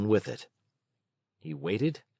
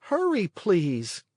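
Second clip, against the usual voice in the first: slow higher plea; begging puppet; 8 semitones higher, slower than usual. A narrator's reading. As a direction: hurry her along. Pinocchio begging.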